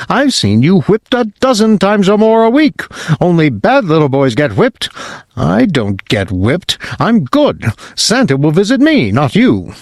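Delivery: put-on high-pitched snotty child, bragging; taunting prince. Spoiled prince taunting the whipping boy, high and smug. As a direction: sneer it like a spoiled little prince.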